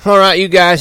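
high, loud order; Curly taking charge, high and loud; commanding call. A narrator's reading.

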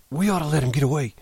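put-on high, agitated old man; urgent, high-pitched warning with swooping tune; excited. A narrator's reading. Candy warns George about Curly, excitedly. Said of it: "But Candy said excitedly".

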